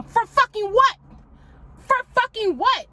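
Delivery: defiant outburst. Shown as a demonstration. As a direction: spit it out in defiance. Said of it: "conversations I will have myself".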